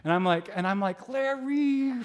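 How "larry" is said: high, drawn-out name; hammed-up high-pitched calling of a name; mock plaintive cry. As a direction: stretch the name out high, imitating awe. Retold reaction to tearful Larry.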